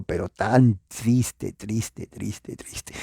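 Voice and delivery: slow, sinking into a hushed whisper on each triste; fading to a whisper. Retold or imitated. Preacher mocking the sad revival song, fading to a whisper. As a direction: let triste die away to a breath.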